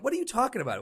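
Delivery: high disbelieving question; incredulous. Retold or imitated. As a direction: scoff at the friend. Friend doubting in retold scene.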